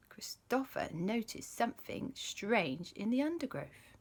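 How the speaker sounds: hushed unvoiced whisper; suspense whisper. A narrator's reading. Storyteller whispers as something strange appears. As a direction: lean in and whisper the mystery.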